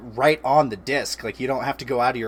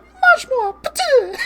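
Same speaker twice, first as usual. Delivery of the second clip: much higher squeaky French gingerbread man; 8 dB louder, slower than usual. Retold or imitated. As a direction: squeal it like the tiny French cookie. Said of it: "and he's just like".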